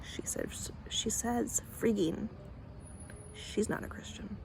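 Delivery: hushed, scandalized gossip whisper; mock-scandalized whisper, slowing on she says freaking. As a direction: whisper it like a shocked churchgoer. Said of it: "Christians who will watch that and be like".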